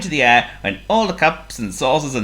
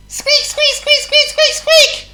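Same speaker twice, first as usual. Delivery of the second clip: volley of very high squeaks, growing louder; squeaking at full pitch; 7 dB louder, much slower than usual. A narrator's reading. John Mouse by the cat's basket, squeaking at full pitch. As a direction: squeal each one at the top of a mouse voice. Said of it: "squeaked at the top of his squeak".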